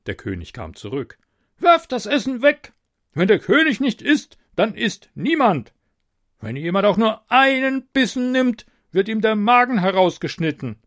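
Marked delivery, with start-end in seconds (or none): 1.6-10.8 high, loud commanding threat; roaring tyrant king. A narrator's reading. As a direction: bark orders like a tyrant. King voiced by narrator.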